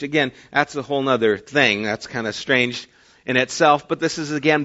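normal talking voice

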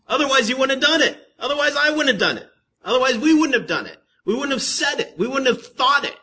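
high, loud, rapid-fire repetitions; impassioned preaching. Real, not acted. Preacher includes himself and congregation, impassioned insistence. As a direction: hammer each line home at speed. Preacher drives the point home.

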